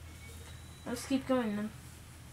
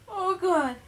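scared; 6 semitones higher, 4 dB louder than usual. Real, not acted. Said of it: "I was so scared".

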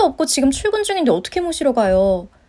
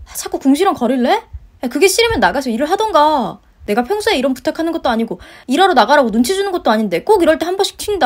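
higher, heated rant that speeds up; angry nagging; 4 semitones higher, 4 dB louder, faster than usual. Acted. Wife scolding her grumbling jobless husband, angry. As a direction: tear into him without letting up.

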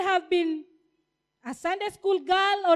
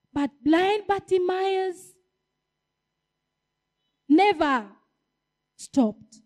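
ordinary delivery

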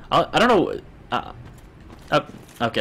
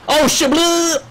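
high, loud panicked yelp; startled scream; 5 dB louder, slower than usual. Real, not acted. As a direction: shriek as if jumped. Attacked in the game.

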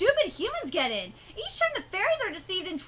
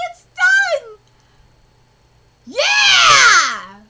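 high, loud triumphant cheer; 5 semitones higher, 12 dB louder, much slower than usual. Real, not acted. Player cheering a finished craft, excited. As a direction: shout the victory.

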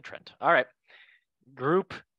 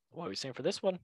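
voice crack; 8 dB quieter, faster than usual. Real, not acted. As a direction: voice cracking mid-question. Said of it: "That was a voice crack".

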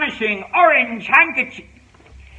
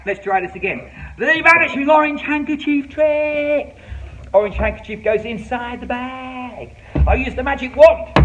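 usual speaking voice

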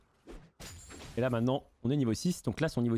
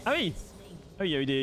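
ordinary talk

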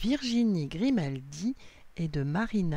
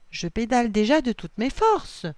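raised, louder, offended comeback; vexed retort; 5 semitones higher, 5 dB louder than usual. A narrator's reading. Noah defends his pedalling to Assa, vexed retort. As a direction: snap back, a touch offended. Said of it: "rétorque-t-il vexé".

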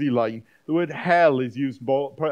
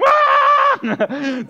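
loud, very high mimicked wail; imitated child's scream; 8 dB louder than usual. Preacher imitating a child someone approaches, screaming. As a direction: burst into a child's screaming cry. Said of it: "They're just, just screaming".

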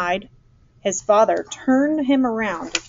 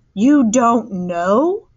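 louder, higher, drawn-out outraged question; erupting; 5 semitones higher, 6 dB louder, much slower than usual. A narrator's reading. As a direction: stretch each word in angry disbelief. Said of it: "Mister Eberhardt erupted".

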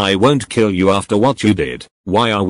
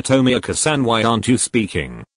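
ordinary talk, nothing marked

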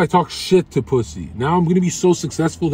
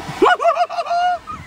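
shrieking laughter, very high; high squealing laugh. Real, not acted. Laughs as blower hits him.

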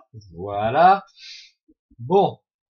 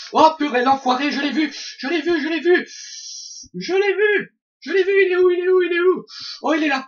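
excited shout; 9 semitones higher than usual. Real, not acted. Player chasing a spotted enemy, excited high yelling. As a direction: yell as you chase him.

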